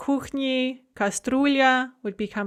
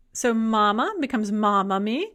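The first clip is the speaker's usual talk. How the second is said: ordinary delivery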